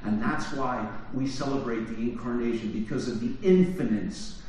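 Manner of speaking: ordinary talk, nothing marked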